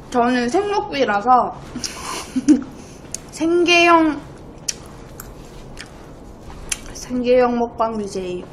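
talking with a mouthful; smacking while chewing. Real, not acted. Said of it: "쩝쩝 소리 너무 많이 나요".

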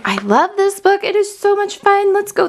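high, bright gush of enthusiasm; real delight after finishing the book; excited. Real, not acted. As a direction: tell them how much you loved it.

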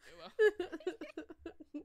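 high, loud, slow laughing line. Real, not acted. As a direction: laugh through it. Laughs at matching names.

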